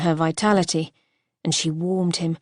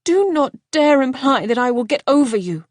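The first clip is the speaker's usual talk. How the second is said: higher, louder, furious warning; fierce; 6 semitones higher, 6 dB louder than usual. Acted. Wife cutting off her husband, fierce and angry. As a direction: cut him off in anger. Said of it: "she cut in fiercely".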